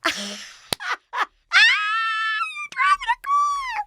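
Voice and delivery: laugh-shout it like it's hilarious; imitating Matt cracking up at a girl arriving by car; mock over-laughing. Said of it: "he laughs really hard at things that aren't funny".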